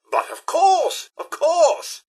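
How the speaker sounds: high-pitched, drawn-out obliging agreement; eager assent. Acted. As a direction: agree effusively, pitch leaping on each course. Butler eagerly agrees to a request.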